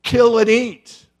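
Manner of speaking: loud, commanding divine voice; voice of God. Retold or imitated. God commanding Peter from heaven, booming. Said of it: "the voice of God said".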